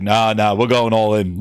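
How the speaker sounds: low, shrugging off the warning; low, careless gambler Phil. Retold or imitated. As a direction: brush it off like a gambler going all in. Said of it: "He's like".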